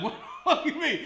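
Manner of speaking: laughing disbelief; incredulous question. Retold or imitated. Asking the Harold's worker about [name], in laughing disbelief. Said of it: "I'm like".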